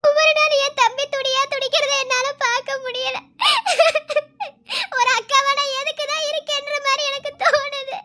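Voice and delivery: high, tearful and helpless; tearful lament. Acted. Sister pours out her grief to her friend, tearful and high. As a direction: weep out the sister's guilt.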